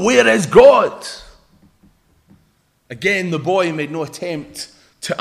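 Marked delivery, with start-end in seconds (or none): 0.0-1.1 loud, slow stern demand; stern and forceful, each word weighted; sterner preacher. Acted. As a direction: raise the voice to demand an answer. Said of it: "in a sterner tone".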